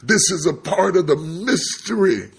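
high, loud pulpit proclamation; full-force preaching. Acted. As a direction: drive it home to the congregation.

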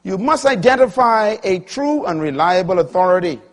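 high, loud pulpit proclamation; full-force preaching. Acted. Preacher announcing the second point, full-force preaching.

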